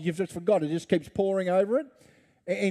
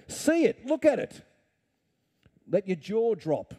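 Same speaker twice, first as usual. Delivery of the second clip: much higher, lifted voice of wonder; amazed urging; 4 semitones higher than usual. Acted. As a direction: point at it in awe.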